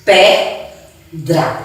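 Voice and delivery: Pedra in two separate beats, pe, dra; syllable by syllable. Shown as a demonstration. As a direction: pause between the syllables of Pedra. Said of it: "abrindo a boca duas vezes".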